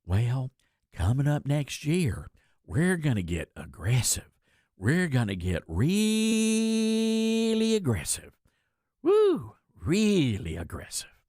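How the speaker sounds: slow, overblown imitation of blustering resolve; mock great gusto. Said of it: "with great force and great gusto".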